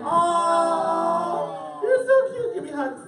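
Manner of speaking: high, loud cooing "oh"; melting, high-pitched gush; gushing affection. Real, not acted. Gushing over children offering hugs.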